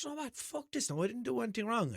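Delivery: retold annoyance. Driver deciding he did nothing wrong, retold annoyed protest. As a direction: voice rises in mock-fed-up indignation. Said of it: "So I got pure annoyed then".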